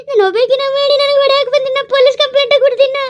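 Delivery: high, tearful wail threatening police; whiny crying threat. Acted. Premi wailing at the neighbours that she'll call police, tearful. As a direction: play up the tears to win sympathy. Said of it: "ಅಳೋದು ಕರೆಯೋದು".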